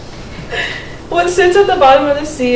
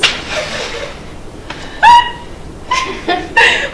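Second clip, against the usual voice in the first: laugh; 4 dB quieter than usual. Real, not acted. Laughs after blonde joke.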